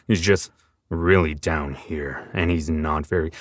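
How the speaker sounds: a hint of the grim soldier; Commander voice. Shown as a demonstration. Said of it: "so that's Commander".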